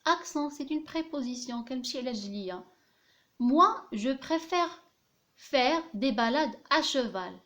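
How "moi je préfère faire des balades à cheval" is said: slow dictation reading. Shown as a demonstration. Dictating the example sentence.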